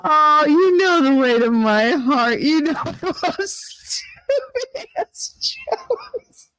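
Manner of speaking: high, slow, breaking into laughter; squeaking out words mid-laugh; laughing hard. Real, not acted. Laughing at the joke.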